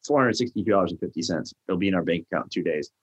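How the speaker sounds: fast, matter-of-fact rattle; rattled off fast. Retold or imitated. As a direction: rush through the numbers. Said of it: "I'm like".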